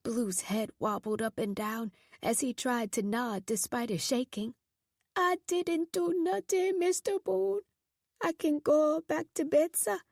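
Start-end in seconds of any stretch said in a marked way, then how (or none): 5.1-10.0 put-on high, scared young voice with a foreign accent; trembling boy with a heavy accent; frightened boy with thick accent. A narrator's reading. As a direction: plead to be let go, voice up high. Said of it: "Blue's homeland thick in his accent".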